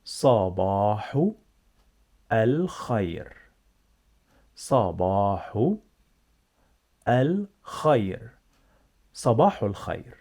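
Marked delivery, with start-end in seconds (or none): 0.1-3.3 "Sabahu", pause, "al khair", each drawn out; slow repeat-after-me model. Shown as a demonstration. Teacher modelling for learners to repeat, "Sabahu al khair" word by word. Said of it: "Repeat after me". 4.6-8.4 "Sabahu" stretched, long pause, then "al khair"; slow repeat-after-me model. Shown as a demonstration. Teacher leading repetition, "Sabahu al khair" slowly in two parts. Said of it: "Repeat after me".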